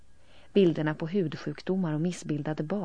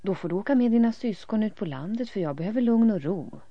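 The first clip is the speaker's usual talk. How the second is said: raised father's voice; 6 semitones higher, 8 dB louder than usual. A narrator's reading. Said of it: "säger pappan".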